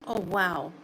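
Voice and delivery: ordinary talk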